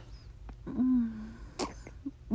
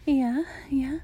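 eager whisper; 5 semitones higher than usual. Acted. Whispers throughout, intimate.

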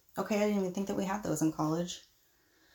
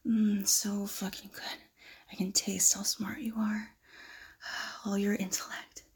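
seductive whisper. Acted. Seducing the professor.

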